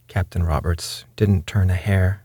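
usual speaking voice

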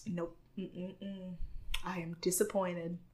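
very soft, muttered mock disapproval; quietly disappointed. Judge Jay watching a contestant's attempt, quietly disappointed. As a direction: imitate a judge's hushed letdown. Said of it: "Jay just sitting there like".

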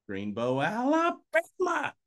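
slow, drawn-out Gump impression, Alabama pitched high; Forrest Gump voice. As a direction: do Forrest Gump saying his hometown. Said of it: "go full Forrest Gump".

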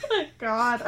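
high, slow, tearful laughing; barely holding it together; laughing to tears. Real, not acted. Laughing to tears while reading.

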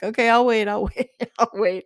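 high, loud, laughing "okay, I'll wait"; laughing reply. Retold or imitated. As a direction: play along with a laugh, a bit impatient. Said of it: "And I'm like".

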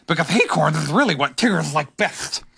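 put-on animal voice; Tigger. A narrator's reading. Tigger warns Pooh about the table, character voice. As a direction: interrupt as an odd, eager creature. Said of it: "said Tigger".

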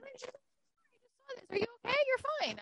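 high sweet nurse. Retold or imitated. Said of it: "She's like".

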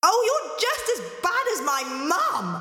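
very high whinge; bratty complaint. Acted. Angelina complaining to the voice in the well, whiny. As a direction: whine it back indignantly.

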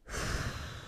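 soft breathy sigh; tired sigh. Real, not acted. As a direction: sigh out quietly. Tired, worn out.

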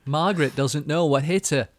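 mock BBC promo announcer; TV trailer voice. Shown as a demonstration. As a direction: sell the sitcom like a promo voiceover. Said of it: "BBC ... accents".

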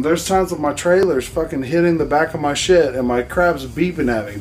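usual speaking voice

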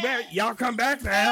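folksy Southern twang, laughing; deep south accent. Acted. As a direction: say it like a country host. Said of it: "It sounds like Redneck Yoda".

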